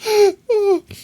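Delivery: a loud high burst of laughter; sudden shrill giggle. Real, not acted. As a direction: cackle at your own tease. Laughing after teasing chat.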